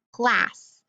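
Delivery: "glass" as plas, the first part of plastic; first syllable alone. Shown as a demonstration. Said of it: "that's my first syllable".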